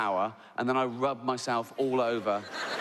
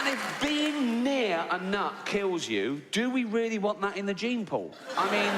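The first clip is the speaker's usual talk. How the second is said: high, laughing through an outraged joke; laughing rant; 6 semitones higher than usual. Real, not acted. Comedian laughing at his own rant, incredulous. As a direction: giggle through the punchline.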